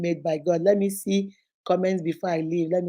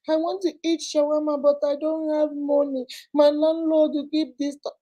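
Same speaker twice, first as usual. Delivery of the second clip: put-on high, tiny baby voice; mock baby whine, high and small; babyish side-chick voice; 7 semitones higher than usual. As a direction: beg like a two-month-old baby. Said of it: "turn to two-month-old baby".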